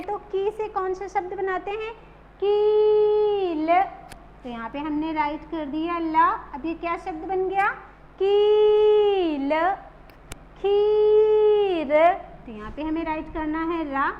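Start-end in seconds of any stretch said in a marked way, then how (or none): 2.4-3.7 कील with the ee drawn out slowly; long stretched ee. Shown as a demonstration. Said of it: "लंबा खींचना है". 8.2-11.9 कील and खीर with the ee held, slow and louder; long stretched ee. Shown as a demonstration. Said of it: "लंबा खींचना है".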